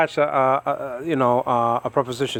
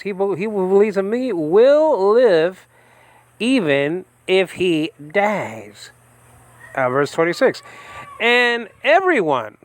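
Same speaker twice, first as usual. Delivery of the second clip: very slow, much higher and louder proclamation; solemn scripture reading; 6 semitones higher, 3 dB louder, slower than usual. Acted. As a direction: stretch every word like a pulpit reading.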